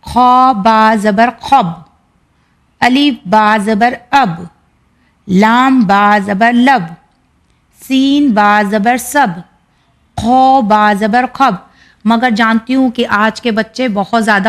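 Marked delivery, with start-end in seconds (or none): 0.0-11.5 slow, deliberate letter-by-letter spelling of خو با زبر خب; teacher slowly spelling خو با زبر خب for children. Shown as a demonstration. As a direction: spell each letter and vowel mark out slowly before joining. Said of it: "یا ہم اس کو اس طرح سے بھی پڑھ سکتے ہیں".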